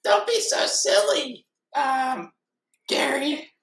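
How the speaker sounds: high-pitched, drawn-out goofy cartoon voice; silly cartoon sponge. Sponge chiding pet Gary in improv, silly cartoon voice. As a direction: play a high, squeaky sponge character.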